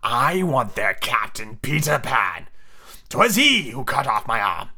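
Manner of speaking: slow, drawn-out villain captain; grand, vengeful pirate; passionate Hook. Acted. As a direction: relish each word slowly. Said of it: "Hook was saying passionately".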